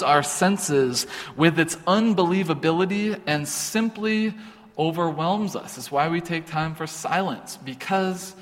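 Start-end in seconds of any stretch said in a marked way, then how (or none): none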